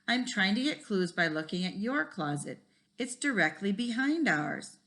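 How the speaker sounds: put-on reading a chapter book aloud to kids; lively read-aloud with voices for each kid; story reading. A narrator's reading. Teacher reads a school mystery to the class, lively.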